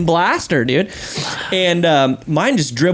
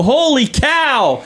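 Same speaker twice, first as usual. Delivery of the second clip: higher, slow exclamation; amazed exclamation; 8 semitones higher, much slower than usual. Retold or imitated. Dad reacting as a kid climbs on the spinning ride, amazed. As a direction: stretch out both words in mock amazement.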